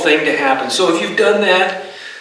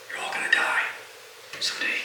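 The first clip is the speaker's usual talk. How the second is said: hushed, breathy whisper of a grim truth; 15 dB quieter, faster than usual. Acted. Preacher whispering to his congregation about death. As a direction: lean in and whisper it to the room.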